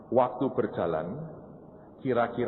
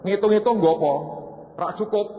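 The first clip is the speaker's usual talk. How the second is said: mock scolding; 9 semitones higher, 3 dB louder than usual. God scolding wife.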